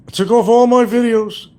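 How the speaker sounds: high, loud mock whiny voice; mocking whine. Mocking the rival, high whiny parody voice. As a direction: parody his boast.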